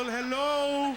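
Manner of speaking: high, loud call. Acted. Climber calling for help, high and loud.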